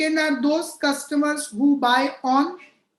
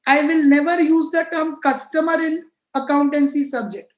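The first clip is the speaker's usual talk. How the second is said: usual speaking voice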